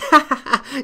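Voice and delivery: burst of laughter. Real, not acted. Laughing at his own joke.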